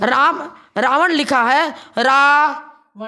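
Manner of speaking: slowly spelling out Ravan; drawn-out teacher reading of Ravan; slow demo reading. Shown as a demonstration. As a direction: read Ravan deliberately slow and loud. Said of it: "अर्थ का अनर्थ".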